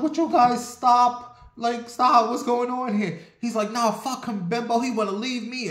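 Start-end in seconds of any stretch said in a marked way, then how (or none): 0.0-3.2 high, raised-voice plea; alarmed retold outcry, pitched up; acted protest. Bystander pleading to stop fight. 3.7-5.7 high-pitched acted grievance; mock indignation without shouting; retold angry refusal. Retold boyfriend refusing to stop.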